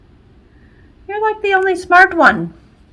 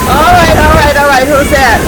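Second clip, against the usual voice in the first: ordinary talk, nothing marked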